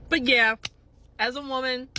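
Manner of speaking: ordinary delivery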